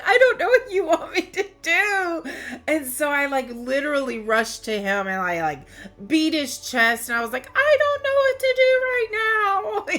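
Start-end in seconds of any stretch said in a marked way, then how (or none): 0.0-2.2 high, loud words half laughed through tears; choked-up laugh. Real, not acted. Retelling her partner's helpless reply to viewers, through choked-up laughter. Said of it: "it's so hard to keep it together right now". 7.5-9.9 high, loud, breaking cry; tearful cry. Real, not acted. Retelling to viewers how she beat his chest, with a tearful cry. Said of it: "I'm not good at holding back tears".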